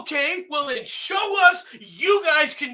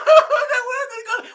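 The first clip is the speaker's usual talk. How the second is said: whiny crying imitation; 11 semitones higher, 6 dB louder than usual. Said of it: "bitch and whine ... cry and go".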